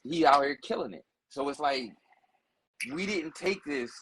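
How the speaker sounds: ordinary talk, nothing marked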